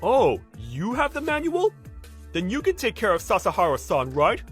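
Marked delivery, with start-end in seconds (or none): 0.0-1.7 drawn-out, high rising question; smug mock surprise. Senior hearing a colleague has the manual, smugly surprised. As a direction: pounce on a handy excuse.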